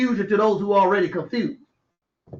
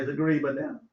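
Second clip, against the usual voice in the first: soft, low correction; hushed mocking correction; 10 semitones lower, 8 dB quieter, much faster than usual. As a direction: say it under your breath, lower. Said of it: "you ain't said it right".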